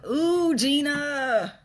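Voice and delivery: high, slow, sing-out of a name; long high-pitched ooh; drawn-out exclamation. Real, not acted. Exclaims on greeting a viewer.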